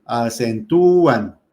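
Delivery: "Acentúan" slowly, with a long loud ú; a-cen-TÚÚÚ-an, stretched hard; stress on the Ú. Shown as a demonstration. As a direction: punch and hold the ú in "Acentúan". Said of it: "cayó en la I del diptongo".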